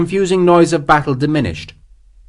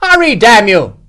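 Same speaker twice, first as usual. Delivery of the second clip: high, loud cursing order; irritable call; 9 semitones higher, 8 dB louder than usual. A narrator's reading. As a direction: shout at your lieutenant to get moving. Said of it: "Morris called irritably".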